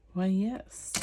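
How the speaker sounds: ordinary talk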